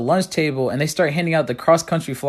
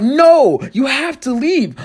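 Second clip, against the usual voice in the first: firm shout; 9 semitones higher, 3 dB louder, slower than usual. Retold or imitated. Kicking friend out.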